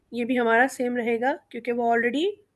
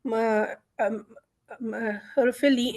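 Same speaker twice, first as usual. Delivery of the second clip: ordinary talk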